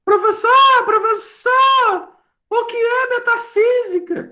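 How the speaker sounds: very high falsetto pupil calling out; questioning student. Acted. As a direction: squeal it like a cartoonishly desperate student. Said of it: "Aí você pode perguntar".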